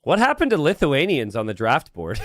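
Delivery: ordinary delivery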